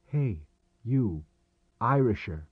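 usual speaking voice

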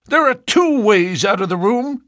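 high, loud, threatening farmer; cried out. A narrator's reading. Ferrier ordering the suitors out, high and loud. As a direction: throw the suitors out with menace. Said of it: "cried Ferrier".

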